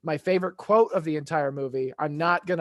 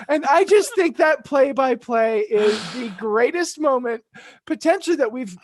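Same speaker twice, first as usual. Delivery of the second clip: ordinary talk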